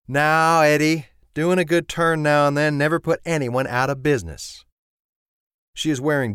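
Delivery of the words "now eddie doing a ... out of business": Southern woman Sissy. A narrator's reading. Sissy chiding the mechanic about business, higher friendly voice. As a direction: play Sissy, bright and chiding. Sissy gently chides Eddie Mac.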